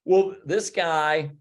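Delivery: normal talking voice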